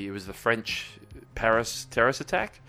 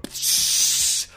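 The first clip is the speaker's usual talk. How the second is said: long hissed spray of fizz; imitated fizz spray. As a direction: hiss like a bottle cracked open. Imitates fizzy bottle spraying open.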